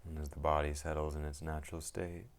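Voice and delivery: calm, unhurried meditation guide with long pauses; guided meditation. Acted. Teacher guiding listeners into settling the mind, calm meditation voice. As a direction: ease them into stillness, one phrase at a time.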